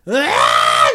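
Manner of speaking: short mock yell of a drunk friend; a drunk friend yelling in a dark bathroom. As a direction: let out a quick hammed-up yell. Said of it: "And just yell".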